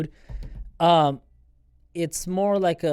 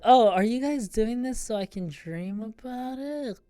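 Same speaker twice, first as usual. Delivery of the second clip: drugged voice; 7 semitones higher than usual. Retold or imitated. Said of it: "I was like".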